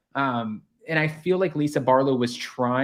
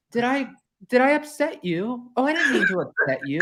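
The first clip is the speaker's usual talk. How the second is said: mock Vanderpump; 8 semitones higher than usual. S1 mimics Lisa Vanderpump dismissively.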